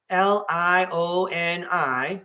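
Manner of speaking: slow, letter by letter; spelled out. Real, not acted. Spelling a mountain town's name for listeners, slowly, letter by letter.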